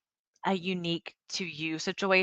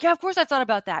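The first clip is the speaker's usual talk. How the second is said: much higher and louder, exasperated; venting friend's annoyed comeback, high and louder; irritated retort; 9 semitones higher, 6 dB louder, faster than usual. Retold or imitated. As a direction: snap back, of course I have. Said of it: "they're like".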